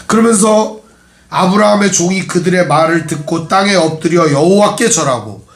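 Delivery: ordinary talk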